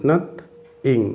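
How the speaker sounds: "nothing" broken as nath, pause, ing; split into syllables. Shown as a demonstration. Teacher repeating two syllables of "nothing", split as nath-ing. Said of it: "দুটো syllable".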